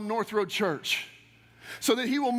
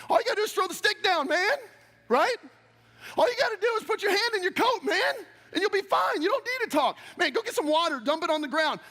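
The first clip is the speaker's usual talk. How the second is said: much higher, fast, pushing the point hard; half laughing at Moses, pressing faster and higher; exasperated preaching; 8 semitones higher, faster than usual. Real, not acted. Preacher chiding Moses to just trust God, exasperated and fast.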